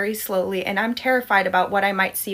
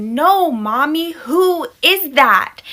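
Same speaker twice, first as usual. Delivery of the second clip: put-on high, loud, drawn-out child voice; slow, insistent small-child demand; frustrated toddler; 6 semitones higher, 5 dB louder, much slower than usual. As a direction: stretch every word in little-kid exasperation. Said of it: "he's getting frustrated with me".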